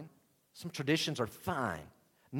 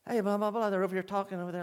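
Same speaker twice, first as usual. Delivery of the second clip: higher-pitched crowd chatter; mock onlooker babble, pitched up; 8 semitones higher, much faster than usual. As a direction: play bystanders gabbing among themselves. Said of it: "Don't you know people are like".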